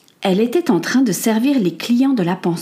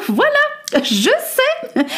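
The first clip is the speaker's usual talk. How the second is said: very high, laughing triumph; Lady Amelia struck by a bright idea; delighted laugh; much slower than usual. Acted. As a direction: giggle as the idea lands.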